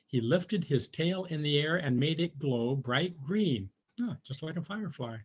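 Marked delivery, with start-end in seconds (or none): none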